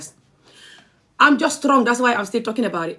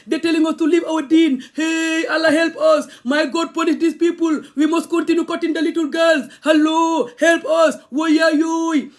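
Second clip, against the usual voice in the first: higher, hammed-up pious wailing; mocking the protesters; 5 semitones higher than usual. Mimicking protesters begging Allah against her, mock wailing. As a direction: parody a zealot crying out to God. Mocks protesters defending FGM.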